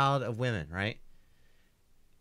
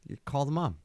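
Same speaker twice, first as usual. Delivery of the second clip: ordinary talk, nothing marked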